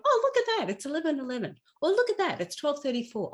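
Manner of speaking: light high "oh, look at that"; mock surprise. Someone spotting a number on the clock, mildly surprised. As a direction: pretend to notice the clock, pleasantly surprised. Imagined reaction to seeing numbers.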